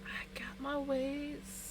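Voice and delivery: slow secretive whisper. Retold or imitated. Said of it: "And she's like".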